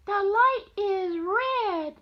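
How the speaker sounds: very high, loud bird cry; little bird voice. Acted. Grandma voicing the little green bird, high and loud. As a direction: squawk it like a tiny bird.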